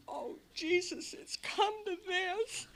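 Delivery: crying self-pity; tearful dismay. Retold or imitated. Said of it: "And I thought".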